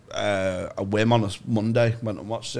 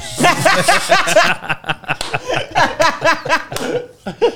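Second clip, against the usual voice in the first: loud high laugh; 7 dB louder than usual. Real, not acted. Big laugh at joke.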